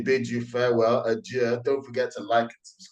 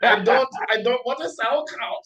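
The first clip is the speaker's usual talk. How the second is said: very high, loud put-on German; spy refusing sauerkraut, overdone; mock German accent; 11 semitones higher, 4 dB louder than usual. Co-host mocking a German spy, loud and high. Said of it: "mock German spy".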